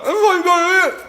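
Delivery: high, loud mock disbelief; exaggerated imitation of shocked viewers; mock viewer concern. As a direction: exclaim it as an appalled onlooker.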